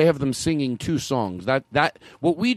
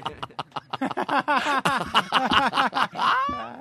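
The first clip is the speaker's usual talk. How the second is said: long, high-pitched cackling laugh; hyena laugh; 10 semitones higher than usual. Real, not acted. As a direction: cackle uncontrollably into the mic. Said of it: "laugh into the mic like a hyena".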